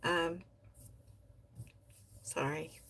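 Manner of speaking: ordinary delivery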